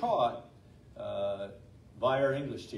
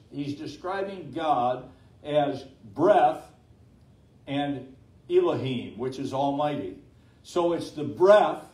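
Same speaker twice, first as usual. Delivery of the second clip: preaching at full force; 4 semitones higher, 10 dB louder than usual. Acted. Pastor preaching to the congregation on God's breath, fervent. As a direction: build the sermon up, pressing each phrase home.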